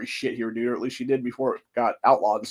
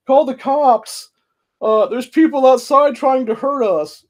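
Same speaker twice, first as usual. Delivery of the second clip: much higher and slow, hammed-up fright; mocking panicked husband; 12 semitones higher, 7 dB louder, slower than usual. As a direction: whine it like a fake-scared coward. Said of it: "And he's like".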